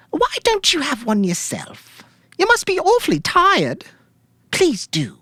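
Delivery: a widow coaxing the detective into a drink; grieving widow's voice. Acted. Widow sweetly urging detective Jack to take a drink, high and fragile.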